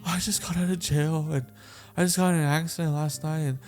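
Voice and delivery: imitated crying. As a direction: act out pouring your troubles out through tears. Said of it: "I started crying".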